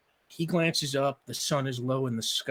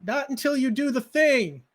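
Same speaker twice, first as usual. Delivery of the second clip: put-on little girl yelling; 13 semitones higher, 7 dB louder than usual. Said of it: "she yells".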